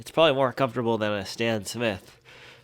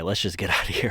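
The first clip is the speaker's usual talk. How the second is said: normal talking voice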